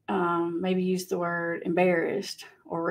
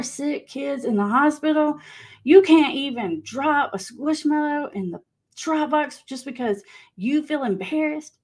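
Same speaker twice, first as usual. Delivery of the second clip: higher, slower mock guilt trip, pressing each word; guilt-tripping; 6 semitones higher than usual. Mom guilt-tripping her shy son over a donation, in a higher, slower scolding tone. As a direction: lay the shame on thick, a touch hammed-up. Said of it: "the guilting, the shaming thing".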